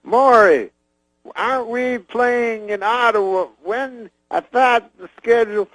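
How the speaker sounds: high, slow, halting impression; Bill Cosby. Cosby checking tour dates with his manager, impression. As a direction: call out high, then question in slow fragments. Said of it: "he goes".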